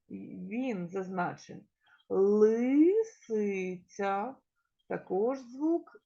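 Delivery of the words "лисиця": slow sounding out. Shown as a demonstration. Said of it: "teacher sounds out word slowly".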